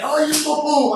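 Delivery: high-pitched video game fighter cry; Street Fighter. Retold or imitated. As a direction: imitate an arcade move call-out. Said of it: "played the game Street Fighter".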